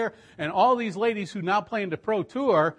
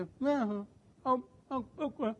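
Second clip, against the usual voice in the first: soft, strained, halting replies; lightly voiced effortful answers; struggling to speak; 8 semitones higher, 6 dB quieter than usual. Paralyzed girl answering her pastor, soft and halting. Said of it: "she could hardly talk".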